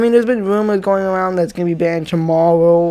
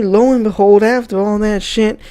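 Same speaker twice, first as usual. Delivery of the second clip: ordinary delivery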